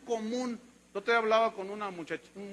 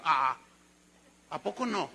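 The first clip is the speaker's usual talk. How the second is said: ordinary talk, nothing marked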